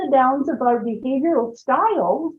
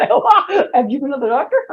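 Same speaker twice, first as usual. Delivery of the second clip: mock surprise. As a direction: tease him back, surprised. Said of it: "I'm going".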